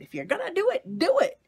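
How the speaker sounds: put-on a dad cheering his kid to go for it, loud and high; excited dad. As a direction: push them on, full of enthusiasm. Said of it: "he was like".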